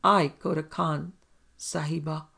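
plain reading voice

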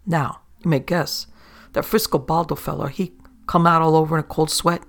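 plain reading voice

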